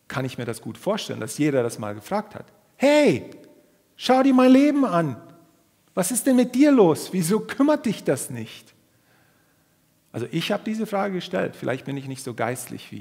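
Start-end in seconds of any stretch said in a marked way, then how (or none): 2.8-8.7 high, loud, exasperated outcry; frustrated believer shouting at God; desperate complaint to God. Retold or imitated. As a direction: demand an answer from God. Everyone questioning God in anguish.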